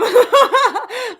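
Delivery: high, loud burst of laughter. Real, not acted. Vlogger amused by a viewer's comment, loud laugh.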